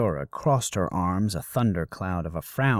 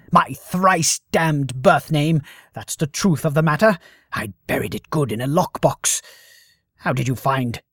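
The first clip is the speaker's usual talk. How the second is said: voz furiosa; 6 semitones higher, 8 dB louder than usual. Acted. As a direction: rage at the hated name. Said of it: "the temper flared anew".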